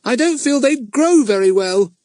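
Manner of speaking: put-on high, far boy's voice, a bit rueful; young boy backing off from the mud; high little boy Pip. A narrator's reading. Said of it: "he said".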